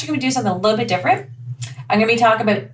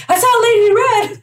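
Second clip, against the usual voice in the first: high, loud mock squeal; excited emoji voice; 13 semitones higher, 5 dB louder than usual. Acting out an emoji for the co-host, high mock squeal. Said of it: "She's like, somebody just pushed an emoji".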